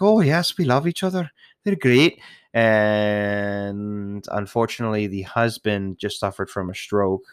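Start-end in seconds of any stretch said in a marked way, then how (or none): 0.0-2.2 high, louder mock Scottish couple; imitating Scottish couple. Old couple answering a fond admirer, mock Scottish voice. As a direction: put on the old Scottish customers' higher voice. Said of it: "and they're like".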